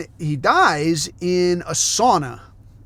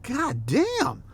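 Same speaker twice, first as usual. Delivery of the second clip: much higher, softer, amazed aside; half-whispered god damn, higher pitched; stunned mutter; 9 semitones higher, 5 dB quieter than usual. Retold or imitated. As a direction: let it slip out in disbelief. Astonished reaction at the bar.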